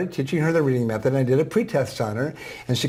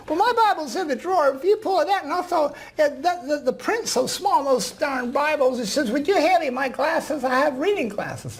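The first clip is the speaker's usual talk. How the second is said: put-on old woman voice; 14 semitones higher than usual. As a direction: voice the ninety-four-year-old gently. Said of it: "I'm gonna use a voice that s-, sounds like her".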